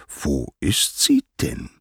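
slow, measured asking at normal pitch; deliberate, restrained inquiry; probing question. A narrator's reading. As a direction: ask it slowly, word by word. Poseidon asks after missing wife.

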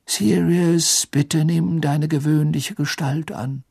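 put-on high, slow, gentle old wizard; lightly voiced kind old headmaster; Dumbledore voice. A narrator's reading.

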